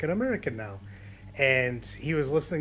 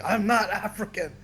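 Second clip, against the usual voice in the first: put-on light Jamaican accent, a bit higher; 7 semitones higher, 4 dB louder than usual. Jamaican friend refusing the label, in a light Jamaican accent. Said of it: "He had that super thick Jamaican accent and be like".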